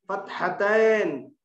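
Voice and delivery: "फतहतैन" spoken very slowly, each syllable spelt out; drawn-out term. Shown as a demonstration. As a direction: stretch the Arabic word for the student. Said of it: "दो जबर को क्या बोलेंगे". (अरबी लफ़्ज़ बहुत धीरे खींचकर)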